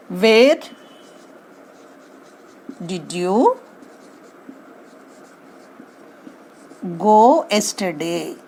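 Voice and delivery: slow, spaced out correct question; question dictated with pauses. Shown as a demonstration. Teacher dictates the correct form, word by word. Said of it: "have to ask like this way".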